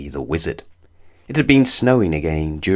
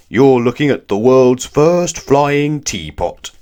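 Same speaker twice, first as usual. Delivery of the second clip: laughing giant; 5 semitones higher, 6 dB louder, slower than usual. Acted. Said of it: "he laughed".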